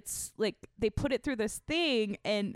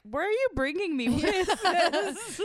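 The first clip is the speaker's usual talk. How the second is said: higher, louder disbelief; incredulous quote; 8 semitones higher, 4 dB louder than usual. Retold or imitated. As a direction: ask it in mock disbelief. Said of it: "And I was like".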